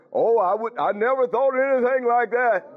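high, loud, mocking prudish protest; mock prim denial. Preacher mocking the prim churchgoers, high loud and prissy. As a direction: play the shocked church folk. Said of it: "Everybody else, they".

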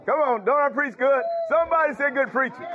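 high-pitched, rousing pulpit call; exuberant preaching. Real, not acted. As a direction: work the congregation for a response.

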